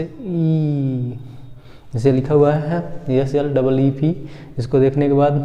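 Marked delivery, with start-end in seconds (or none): 0.0-1.2 ई held long; teacher demo of long ee. Shown as a demonstration. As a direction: stretch ई into a long ee. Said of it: "कैसे?".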